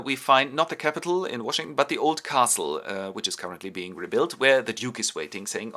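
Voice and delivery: ordinary delivery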